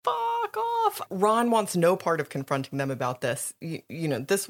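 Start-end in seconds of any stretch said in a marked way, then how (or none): 0.0-1.0 squeaky high mocking brush-off; a hammed-up rude dismissal; mock Ron brush-off. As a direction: toss it off in a silly high voice. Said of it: "Ron's like".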